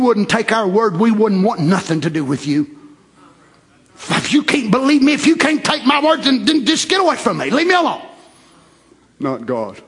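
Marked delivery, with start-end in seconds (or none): 4.1-8.0 high-pitched, mildly exasperated brush-off; acted rejection. Preacher voices people fed up with doubters, mock exasperation. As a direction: act out sending a doubter away.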